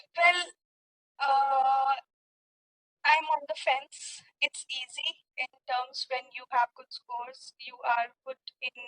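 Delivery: ordinary talk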